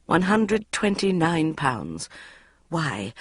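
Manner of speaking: ordinary delivery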